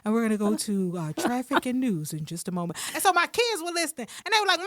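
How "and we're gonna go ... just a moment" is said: lower, smooth DJ read; lightly lowered announcer tone; radio announcer voice. Retold or imitated. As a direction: sound like a mainstream radio host.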